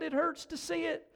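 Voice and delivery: high-pitched pained protest; crying out. A narrator's reading. Cave people hurt by the new light, crying out. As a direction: keep the pitch right up, complaining in distress. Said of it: "they cried".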